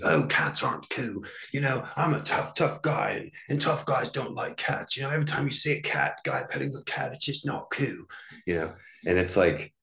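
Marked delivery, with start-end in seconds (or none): 0.0-8.0 higher, mock macho voice, quicker in the middle; Andrew Tate impression. Tate dismissing cats as uncool, mock macho voice. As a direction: sneer at cat lovers in a mocking macho voice. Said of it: "He's like".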